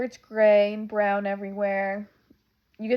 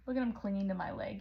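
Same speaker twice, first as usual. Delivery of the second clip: ordinary talk, nothing marked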